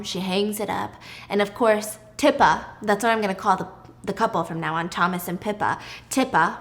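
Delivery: usual speaking voice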